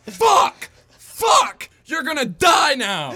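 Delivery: shrieking high and loud at a brother; furious yell. Retold or imitated. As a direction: scream it like you're about to pound him. Brothers fighting, acted yell.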